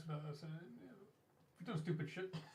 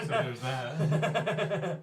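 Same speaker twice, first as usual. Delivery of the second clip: laughing; 9 dB louder, much slower than usual. Real, not acted. Laughing at own joke.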